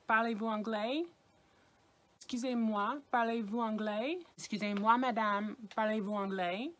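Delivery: Parlez-vous anglais with the pitch rising at the end; rising question tune. Shown as a demonstration. Teacher showing students the question tune. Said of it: "with the intonation in your voice".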